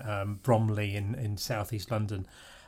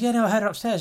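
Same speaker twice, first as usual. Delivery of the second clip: high, louder put-on friend's voice; imitating the friend; 12 semitones higher, 6 dB louder than usual. Friend telling brother he heard someone upstairs, high and puzzled. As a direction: replay the puzzled guest, pitched up. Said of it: "He goes".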